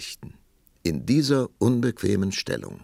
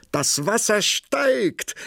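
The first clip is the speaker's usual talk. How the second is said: hoher, lauter, gedehnter Ausruf; laut und hoch aufrufen, die Wörter gedehnt; 6 dB louder, slower than usual. A narrator's reading. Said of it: "sprang die Prinzessin auf und rief". (aufgeregt gerufen)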